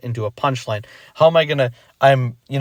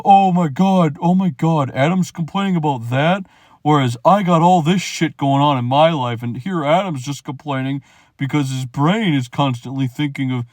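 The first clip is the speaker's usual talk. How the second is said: sneering imitation of a whiny complainer; mocking critic; 2 semitones higher than usual. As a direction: parody the people who twist his words. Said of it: "I'm not saying".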